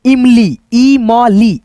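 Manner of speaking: इमली split into ई, म, ली, each sounded apart; syllable by syllable. Shown as a demonstration. Teacher sounding out इमली slowly for children. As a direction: break इमली into its syllables for kids. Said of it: "teacher syllable-splitting demo".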